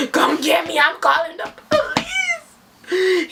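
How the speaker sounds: high, drawn-out desperate wail; panicked demand. Retold or imitated. Stranded runner threatens the driver with police, panicked. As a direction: wail it into the phone, pitch climbing. Desperate phone call while running.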